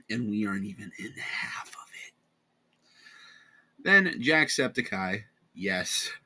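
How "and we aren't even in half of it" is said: whispered aside. Real, not acted.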